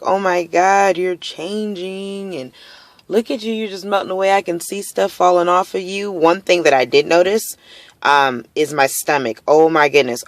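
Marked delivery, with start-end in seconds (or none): 0.0-6.1 gushing onlooker voice, higher and put-on; imitating people. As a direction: mimic people marveling at her weight loss, pitched up. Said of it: "they're like".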